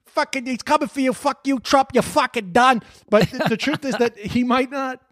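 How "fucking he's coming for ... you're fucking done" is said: higher, tough-guy ranting voice put on; mock a blustering New York actor threatening Trump; Michael Rappaport impression. Podcast host mimics Michael Rappaport's threat, higher ranting tough-guy voice. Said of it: "Michael Rappaport was there being like".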